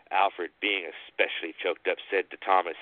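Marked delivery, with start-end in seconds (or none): none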